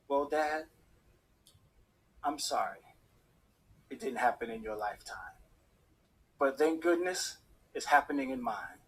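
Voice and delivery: low, soft words to a late father; tearful. Retold or imitated. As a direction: speak gently and haltingly, with long pauses. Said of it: "a tear started rolling down my face".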